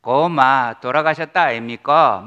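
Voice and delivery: loud, blunt Gyeongsang dialect; lightly imitated Gyeongsang speech; Gyeongsang accent. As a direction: punch it out like a Gyeongsang man. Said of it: "경상도 사람은".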